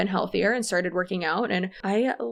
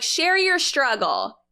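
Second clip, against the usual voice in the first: higher, louder and slow, hammed-up sales pitch; mock cheerleader slogan; 7 semitones higher, 5 dB louder, slower than usual. Mocking the MLM companies that urge reps to overshare, hammed-up rally cry. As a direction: sell it like a gushing upline.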